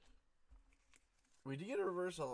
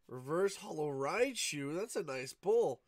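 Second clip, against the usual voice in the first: slow, louder pleased call-out; excited; 3 semitones higher, 7 dB louder, slower than usual. Real, not acted. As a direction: announce the good pull with delight. Pulls a good card.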